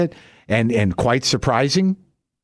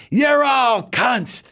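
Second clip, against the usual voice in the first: put-on high, shrieking curses from an old woman; high, slow shrieked rant in a little old lady's voice; old lady screaming; 10 semitones higher than usual. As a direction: scream like a furious old lady alone at home. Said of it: "on a tirade".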